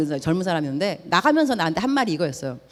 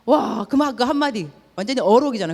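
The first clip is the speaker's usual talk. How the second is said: ordinary talk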